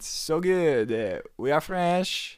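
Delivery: lightly hammed-up French accent, slow; a touch of French accent, proud; mock French accent. Co-host playing up being French, light French accent. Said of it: "A great French accent".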